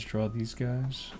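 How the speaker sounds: unhurried quiet murmur while sketching; slow, soft repeat. Shown as a demonstration. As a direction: say it again slowly under your breath. Repeating a phrase while drawing.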